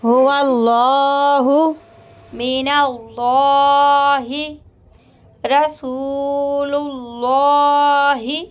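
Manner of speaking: slow, drawn-out tajweed recitation; slow Quranic recitation of example words. Shown as a demonstration. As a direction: chant each word slowly as in recitation. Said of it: "अल्लाह के लाम को पूर पढ़ेंगे".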